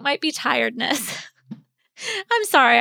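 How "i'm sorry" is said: laughing. Real, not acted. Laughs at own yawning.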